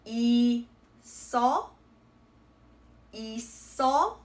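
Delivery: 이서 as a long open i, then so with the s; carried double consonant. Shown as a demonstration. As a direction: slowly split 이서, s starting the second syllable. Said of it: "this word would be read as".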